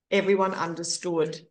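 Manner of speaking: ordinary talk, nothing marked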